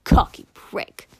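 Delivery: loud, hissed sharp insult. Acted. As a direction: spit the words at him. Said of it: "she said sharply".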